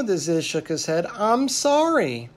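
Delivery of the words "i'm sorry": high, drawn-out plaintive apology; pleading storybook apology; heartfelt apology. A narrator's reading. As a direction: plead it slowly, voice lifted. Ziz apologizes to God.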